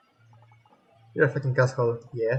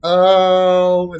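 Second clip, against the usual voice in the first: shocked exclamation; 5 semitones higher, 10 dB louder than usual. Real, not acted. Shocked discovery in game.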